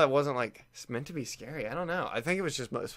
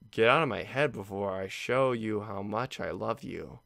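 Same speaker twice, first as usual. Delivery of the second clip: slow creepy reading; 5 semitones lower than usual. Acted. Rereads poem, slower.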